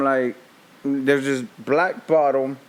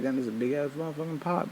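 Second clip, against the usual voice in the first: muttered gripe; 11 dB quieter, faster than usual. Retold or imitated. Retold grumble washing the pot.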